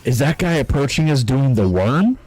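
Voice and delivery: a soldier shouting in disbelief at the dancer; startled soldier. Retold or imitated. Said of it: "It's like".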